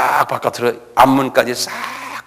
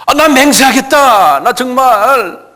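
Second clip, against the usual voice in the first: loud, high, drawn-out protest; vehement oath; 11 semitones higher, 5 dB louder, much slower than usual. Acted. Peter denying to his accusers, vehement swearing. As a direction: swear it at the top of your voice.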